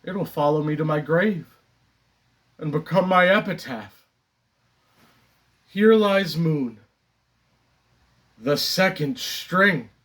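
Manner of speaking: measured epitaph with long pauses; deliberate stage reading of a gravestone line; theatrical Moon monologue. Acted. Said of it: "the character Moon".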